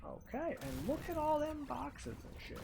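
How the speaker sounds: high, eager delight; thrilled at the loot; excited. Real, not acted. Gamer spotting loot boxes, high excited voice.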